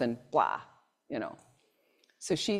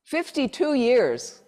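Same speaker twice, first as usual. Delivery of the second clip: higher, louder, slow disbelieving echo; amazed echo; 6 semitones higher, 6 dB louder, slower than usual. Real, not acted. Lecturer repeating an audience answer, amazed and slow. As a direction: stretch it out in wonder.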